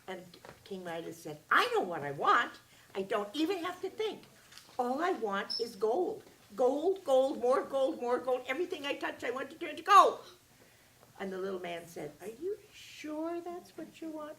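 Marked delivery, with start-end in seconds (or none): 1.5-10.2 loud, high, gold hammered over and over; a king grabbing at his wish, shouting for gold; greedy excitement. Acted. 12.2-14.4 wary little man. Acted. Little man warns the king.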